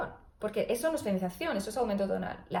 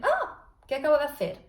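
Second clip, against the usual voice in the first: a sudden high startled cry in head voice; surprised head voice; 4 semitones higher, 3 dB louder than usual. Shown as a demonstration. Therapist demos how surprise pops into head voice. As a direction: jump up into head voice as if just startled. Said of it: "Voz de cabeza".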